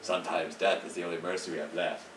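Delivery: low, measured hero offering grave help; game character voice. Acted. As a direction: play the solemn hero, slowing at the end. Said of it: "I did use different voices".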